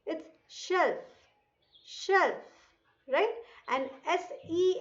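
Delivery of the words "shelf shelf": 'shelf' pitched up, with a clear sh onset; 'sh' in 'shelf'. Shown as a demonstration. Teacher repeats the word for children, 'shelf' with sh. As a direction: open 'shelf' on a distinct sh. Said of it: "the pronunciation of S H E L F".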